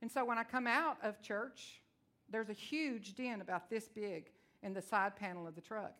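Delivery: ordinary talk, nothing marked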